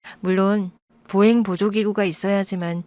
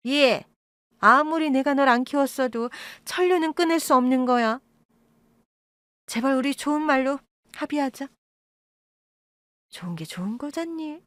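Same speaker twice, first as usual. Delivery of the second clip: higher, coaxing, fading at the end; wheedling plea; 5 semitones higher than usual. Acted. Birth mother pleading blood ties with daughter, higher coaxing voice. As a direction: sweet-talk her, higher.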